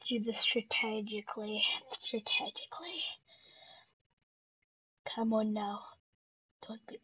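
soft whispered plan; quiet whispered strategy. Real, not acted. Planning quietly mid-match.

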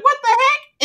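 high startled exclaim; mock startled yelp; surprised. Retelling the spider scare, surprised. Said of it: "It was like".

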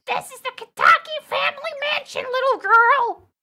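high, loud character-lady voice; Scottish lady attempt. Lady to little girl at family mansion, high loud character voice. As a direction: push the lady's voice way up high and loud. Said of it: "I have to give her a voice".

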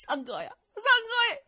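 high wailing cry of the brother's name; joining the wailing. Acted. Sworn brothers joining Cheng's fake mourning, wailing. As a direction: cry along in mock grief. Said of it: "也跟着程咬金哭起来了".

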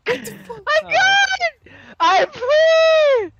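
yelling with relief; excited shout. Real, not acted. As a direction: shout it out in triumph. Escapes trap in game.

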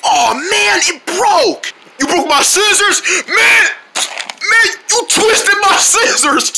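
high, frustrated yelling; upset shout. Acted. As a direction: yell about the broken scissors. Scissors broke, kid upset.

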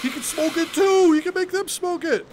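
high, loud delighted yell; excited shout. Real, not acted. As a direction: burst out with glee. Delighted discovery in mod.

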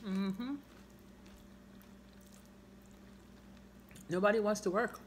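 talking between bites; chewing food. Real, not acted. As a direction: mouth partly full. Seen 1: closed, working mouth early on; fork of salad held throughout.

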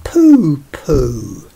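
loud, scoffing pooh-pooh; dismissive pooh-pooh. Acted. Madeline dismissing the ghost with a scoffing pooh-pooh. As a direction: brush it off with disdain. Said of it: "But Madeline just said".

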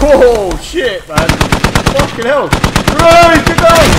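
loud alarmed yell; shouting. Real, not acted. Sudden firefight, yelling warning.

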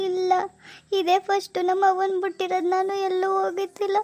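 homesick, tearful plaint. Acted. New bride tells husband she misses her mother, tearfully. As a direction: say it as if holding back tears. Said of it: "ಅಳ್ಬೇಡ ಬಿಡಿ, ಅದ್ಕೆ ಕಳ್ತೀರಾ?".

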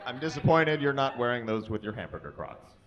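normal talking voice

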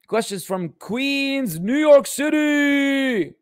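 slow, rising to a loud high New York City; drawn-out host shout; announcer call. Real, not acted. Announcing the next question's origin.

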